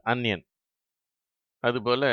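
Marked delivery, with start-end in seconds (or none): 0.0-0.4 அன்யன் with short a, not ஆனியன்; correct pronunciation. Shown as a demonstration. Teacher showing pupils the correct English sound. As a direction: say அன்யன் quickly with a short first a. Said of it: "சரியான உச்சரிப்பு".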